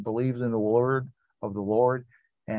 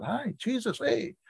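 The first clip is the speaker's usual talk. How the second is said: casual greeting; 12 semitones higher, 5 dB quieter, slower than usual. Pretend. Preacher voices a casual greeting.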